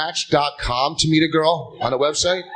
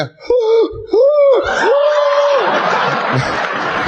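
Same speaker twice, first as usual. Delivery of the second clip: big laugh; 5 dB louder than usual. Real, not acted. Crowd and comic laughing hard.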